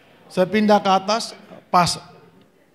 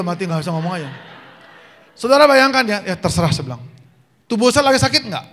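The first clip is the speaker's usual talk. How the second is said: normal talking voice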